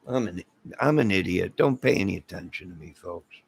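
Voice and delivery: ordinary talk, nothing marked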